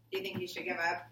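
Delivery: usual speaking voice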